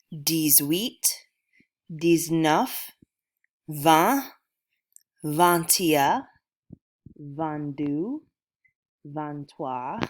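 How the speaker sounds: slow, careful French counting; French numbers. Shown as a demonstration. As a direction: say each French number clearly with a pause after it. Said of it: "I'm gonna go ahead and say these in French".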